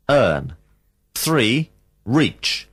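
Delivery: ordinary talk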